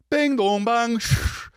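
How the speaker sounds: high sung-out bing dong bang, shh; vocal sound effects for a show's opening; imitated show intro noises. Mimicking the TV intro to friends, high sound effects.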